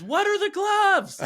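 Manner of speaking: very high, drawn-out mock kid shriek; slow, high-pitched demanding yell, imitated; imitated child's scream. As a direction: wail it like an upset little girl. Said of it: "she's screaming".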